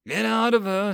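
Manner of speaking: plain reading voice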